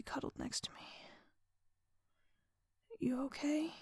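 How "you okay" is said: soft, higher, gentle question; hushed, caring question; genuinely concerned. A narrator's reading. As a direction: check in quietly with concern. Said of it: "genuinely concerned".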